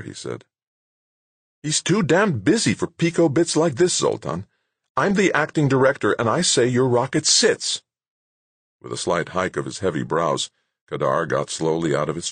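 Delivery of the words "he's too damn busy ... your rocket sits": high, forceful telling-off; irritated director ending the argument; pulling rank angrily. Acted. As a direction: lay down the law, exasperated. Director pulls rank in quarrel.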